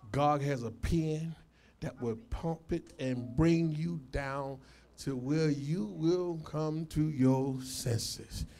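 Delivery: low, soft, weighty warning; slow down and drop your voice to warn them; hushed, deliberate pulpit counsel; grave preaching admonition. Real, not acted.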